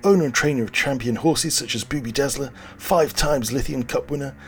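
high, loud, shouty pitchman; brash huckster. Acted. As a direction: sell it like a sleazy infomercial. Brash twin pitches crypto.

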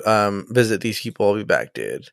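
low, quiet, trailing off; mock Picard. Picard casually leaving to visit Sarek's wife, low fading mock. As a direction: let it sink low and fade out.

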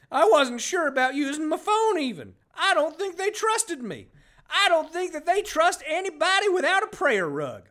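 high, loud put-on voice for the character; corrected character voice. Shown as a demonstration. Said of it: "I'm doing the wrong accent there".

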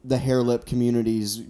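normal talking voice

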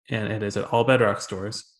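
ordinary delivery

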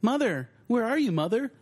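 high, plaintive fawn calling; Bambi calling for mother. Retold or imitated. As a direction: call out like a lost fawn. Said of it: "tease my sister by just going".

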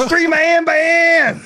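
high, loud, slow wrestler shout; Heath Slater catchphrase. Retold or imitated. Guest shouts the catchphrase at the hosts, loud and high. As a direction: bellow it like a ring entrance. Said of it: "Heath Slater vibe".

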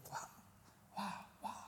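breathy awed whisper; whispered awe. Real, not acted. Remembering visions while praying, hushed awed whisper. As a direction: let the wonder slip out under the breath.

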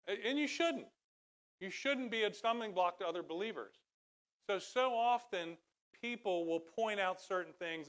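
high, loud pulpit exhortation; fervent preaching. Real, not acted. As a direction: urge the congregation firmly. Preaches at full force.

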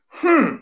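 high-pitched mock "hmm"; high hum, imitating the teller; retold puzzled hum. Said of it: "She goes".

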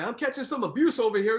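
mock complaint. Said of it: "he's like".

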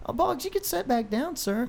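mock polite. Real, not acted. Mock formal dismissal.

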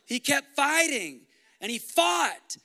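rousing preacher at full force; full-force preaching. Acted. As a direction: hammer the victory home. Sermon climax, loud.

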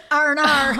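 a hammed-up Australian "R and R"; Australian accent. Shown as a demonstration. Host blurting "R and R" in Australian, with a laugh. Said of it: "you get your lovely Australian".

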